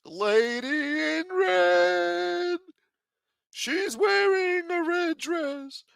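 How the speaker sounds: very high and slow, drawn-out put-on voice; slow high mock informant. Still playing the snitch, he describes the lady in red, high and slow. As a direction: stretch every word like a cartoon snitch.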